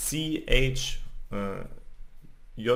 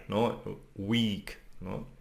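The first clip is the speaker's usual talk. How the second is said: ordinary delivery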